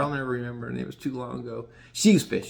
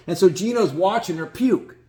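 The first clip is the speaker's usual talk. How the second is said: normal talking voice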